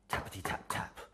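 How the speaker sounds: whispered knock. Acted.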